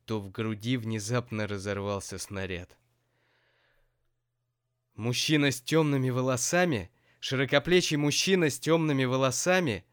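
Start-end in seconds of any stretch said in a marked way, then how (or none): 5.0-9.9 loud, high jealous yell. A narrator's reading. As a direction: shout it as jealousy explodes. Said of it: "заорал Сеня".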